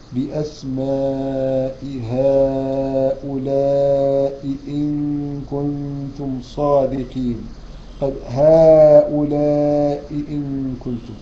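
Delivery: slow, chanted verse recitation; Quran recitation. Acted. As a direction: recite with full tajweed.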